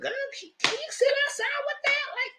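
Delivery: high teasing "sit outside"; sassy tease. Retold or imitated. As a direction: shoo her out in a high sassy voice. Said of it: "I said".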